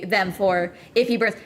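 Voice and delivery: ordinary talk, nothing marked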